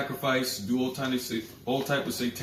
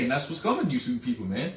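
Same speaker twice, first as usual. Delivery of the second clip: ordinary talk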